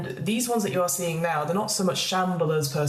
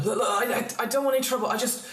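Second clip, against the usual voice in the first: high, stammering plea for his life; frightened man; 5 semitones higher, faster than usual. Acted. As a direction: play a panicky man begging. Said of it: "a frightened-looking man".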